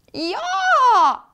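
very high, loud bleat; bleating yes. Acted. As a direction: bleat it like an eager little goat. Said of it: "brekte den minste".

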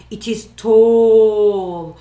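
slow and loud, tall drawn out; stretched for tall. Shown as a demonstration. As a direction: make the words as tall as the giraffe. Teacher acts out tall giraffe.

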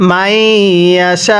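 slowly drawn Quranic reading with a rising end; slow recitation. Shown as a demonstration. Teacher reciting the next word to students, slow chant. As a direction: recite it long and even. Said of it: "তাহলে".

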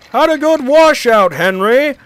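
high, loud teasing call; jeering shout to someone across the yard. A narrator's reading. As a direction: call it out tauntingly, pitched right up. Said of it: "called a voice".

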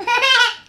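sudden high loud laugh; loud burst of laughter. Real, not acted. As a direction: let out a big cackle.

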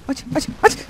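breathless whispered urging; whispering in fear; frantic whisper. Real, not acted. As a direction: whisper it in a rush. Frantic under pressure.

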